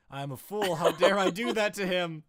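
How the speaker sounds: building mock indignation; mock self-reproach. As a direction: berate yourself in mock horror. Mock outrage at own misspelling.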